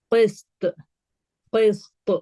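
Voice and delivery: heavy bouncing T ending قسط; قسط released as "qis-to"; heavy qalqala. Shown as a demonstration. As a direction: recite قسط with a thick echoing final T. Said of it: "تو یہ bounce back ہو گیا".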